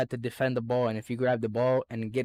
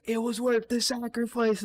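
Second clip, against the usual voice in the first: higher, lightly put-on voice of the crowd; mock consolation; 9 semitones higher than usual. Classmates consoling him over broken glasses in a higher mock voice.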